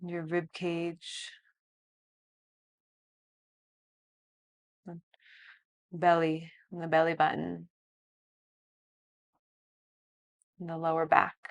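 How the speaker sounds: guided meditation. Acted. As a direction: lead a calm body scan. Guides a body scan.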